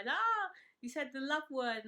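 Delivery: loud, teasing ribbing from the lads. Retold or imitated. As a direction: rib him about the love word. Boys teasing Luke in villa.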